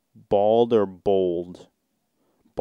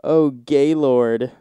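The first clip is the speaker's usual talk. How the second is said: slow, louder hammed-up moan; a drawn-out fake moan, louder; mock moan; 3 semitones higher, 6 dB louder, slower than usual. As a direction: moan the name out like in bed. Moaning names read from sex thread.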